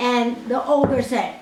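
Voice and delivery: usual speaking voice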